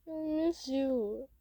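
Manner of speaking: slow, drawn-out mock tearful voice; tearful mutter. A narrator's reading. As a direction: play at muttering through tears. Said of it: "mutters out through his tears".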